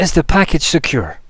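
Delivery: high, loud, worried question; anxious snail man. Acted. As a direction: fret over the package, voice jumping up. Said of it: "He's very concerned".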